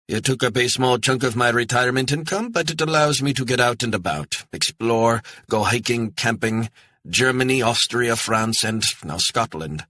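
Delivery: a touch of a German man proud of his RV; lightly voiced proud German retiree. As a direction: list the places like a proud retiree. [name] proudly lists his travels.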